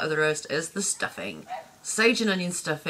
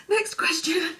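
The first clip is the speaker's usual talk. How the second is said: ordinary delivery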